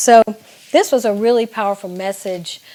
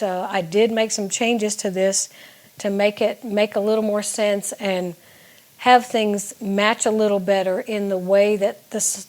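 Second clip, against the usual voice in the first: ordinary talk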